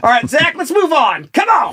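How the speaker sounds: high, loud push to move on; excited prompt. Real, not acted. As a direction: hurry him along to the next topic. Host pushing to next segment.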